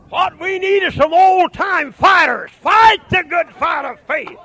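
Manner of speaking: shouting the call to fight; preaching at full force. Acted. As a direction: thunder it out to the congregation.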